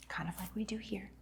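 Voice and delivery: soft unvoiced aside to the room; hushed knowing aside; whispered aside. Real, not acted. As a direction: let them in on it in a whisper. Aside about the congregation.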